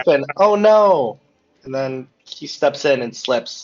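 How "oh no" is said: mock alarm. Acting alarm at a fall.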